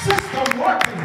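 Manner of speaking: high, shouted preaching chant; preaching at full force. Acted. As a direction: hammer each line like a preacher.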